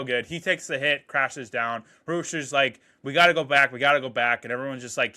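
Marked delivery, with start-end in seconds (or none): 3.0-4.4 raised, pressing repeat; urgent plea. Retold or imitated. Rooster begging the squad to go back, urgent. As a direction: push hard to turn back. Rooster urging a return mid-battle.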